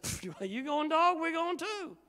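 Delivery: high, loud mocking voice; hammed-up high taunt; mocking disciples. Preacher mocking the disciples inviting Jesus along, high and loud. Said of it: "They said".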